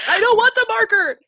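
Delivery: put-on high, loud toddler whine; whiny toddler. Mom imitating her toddler's high loud whine.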